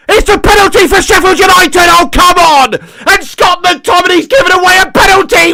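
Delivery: high, loud, outraged yelling; furious shout. Real, not acted. Fan raging at his player for conceding the penalty, shouting. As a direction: rant at the screen at full volume.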